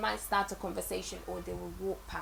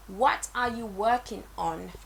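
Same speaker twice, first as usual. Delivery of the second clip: ordinary delivery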